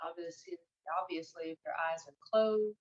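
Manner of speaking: soft, unhurried meditation guide; guided meditation. Acted. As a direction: ease them gently into stillness, leaving long pauses. Leads listeners into meditation.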